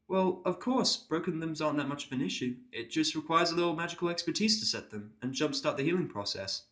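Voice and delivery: a careful, apologetic pony nurse. Acted.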